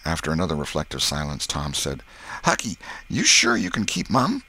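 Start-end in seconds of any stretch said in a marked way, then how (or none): 2.4-4.4 put-on higher-pitched boy asking warily; Tom's boyish voice, anxious check. Tom asks Huck to stay silent, earnest boy voice. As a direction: ask your pal in a raised boy's voice.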